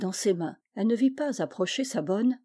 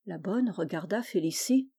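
ordinary delivery

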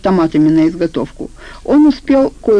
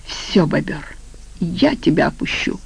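strangled wheeze; 5 dB quieter than usual. Acted. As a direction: croak the threat as if half-strangled. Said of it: "стал похож на хрип удавленника". (сдавленный хрип удавленника)

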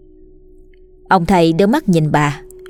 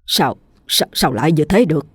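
a mild stammer, broken and unsure; a touch of frightened stammering; stammering in shock; 2 semitones lower than usual. A narrator's reading. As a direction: stumble over the words, shaken by what he sees. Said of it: "Ông lắp bắp".

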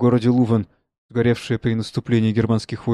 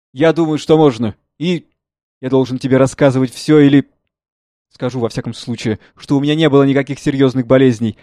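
lightly voiced father; 2 semitones higher, 8 dB louder than usual. Father explains health.

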